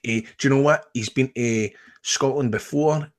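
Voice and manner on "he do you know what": usual speaking voice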